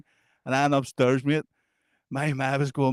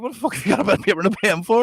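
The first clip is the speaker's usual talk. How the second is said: fast, incredulous what-the-fuck; baffled disbelief; 6 semitones higher, 3 dB louder, faster than usual. Retold or imitated. Mates gawping at Sammy pulling out paper, incredulous. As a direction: blurt it out in disbelief.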